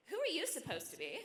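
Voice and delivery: put-on a small child challenging a grown-up, voice swinging widely; swoopy, high-starting child voice; little girl's voice. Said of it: "this little girl".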